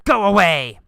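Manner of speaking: acted angry shout, sent at him; angry yell. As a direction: yell it like you've lost patience. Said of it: "I get angry and I yell at him".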